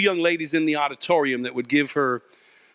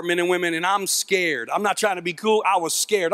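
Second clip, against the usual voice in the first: ordinary delivery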